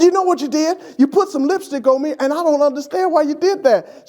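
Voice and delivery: high, hammed-up indignation; teasing mock outrage at his wife. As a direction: play up fake offence at the lipstick. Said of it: "that's mock outrage".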